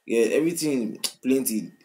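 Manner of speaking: ordinary delivery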